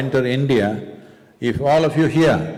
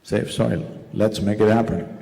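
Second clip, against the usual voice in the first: usual speaking voice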